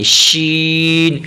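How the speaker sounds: شین long, lips rounded; slow rounded-lip شین; rounded sheen. Shown as a demonstration. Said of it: "ہونٹوں کو تھوڑا سا آگے".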